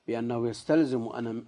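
normal talking voice

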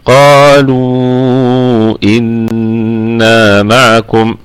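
very slow recitation with long held vowels; madd in recitation. Shown as a demonstration. Tajweed teacher demonstrating the connected madd, slow sustained recitation. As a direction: stretch "قالوا" long before "إنا" and hold the nasal n. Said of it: "في حال الوصل ستكون مد".

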